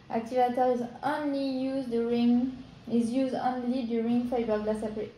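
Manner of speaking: English read with a strong French accent. Real, not acted. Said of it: "avec mon super accent français". (anglais lu avec un fort accent français)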